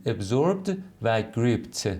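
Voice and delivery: Absorbed and gripped drawn out very slowly; pronunciation model for learners, very slow; careful pronunciation. Shown as a demonstration. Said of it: "به تلفظش هم دقت کنید".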